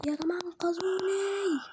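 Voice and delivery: high, slow half-joking cry for help to the viewers; the cuffed player begs his viewers, high and slow, mock pleading; mock plea for rescue. Player cuffed by dealer, begging viewers.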